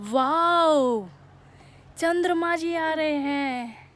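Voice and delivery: thrilled wow at the moon; slow, wonder-filled exclaim; excited. Real, not acted. Sees the moon rising.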